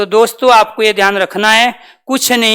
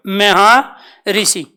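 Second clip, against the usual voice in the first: Maharshi drawn out very slowly, each syllable separate; slow pronunciation; much slower than usual. Shown as a demonstration. Teacher slowly sounds out Maharshi for students. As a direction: spell out Maharshi by sound, unhurried. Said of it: "क्या उच्चारण करोगे?".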